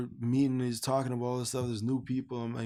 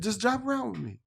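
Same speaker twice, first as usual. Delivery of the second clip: much higher, louder coaxing line; insistent stranger; 11 semitones higher, 5 dB louder than usual. Retold or imitated. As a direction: coax him like it's no big deal. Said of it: "He's like".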